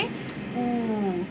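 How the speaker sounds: ऊ drawn out long; long vowel. Shown as a demonstration. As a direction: stretch the ऊ sound long. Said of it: "लंबा खींच के".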